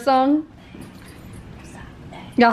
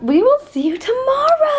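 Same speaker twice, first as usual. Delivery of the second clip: singsong sign-off; 12 semitones higher than usual. Pretend. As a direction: call it out high to the viewers. Exaggerated vlog sign-off to viewers.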